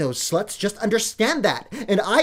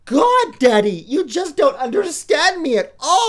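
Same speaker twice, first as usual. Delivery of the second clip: high, louder exasperated groan with a laugh; exasperated whine; 8 semitones higher, 4 dB louder than usual. Acted. Spoiled daughter whining at her daddy, high and exasperated. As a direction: roll your eyes and whine at Daddy.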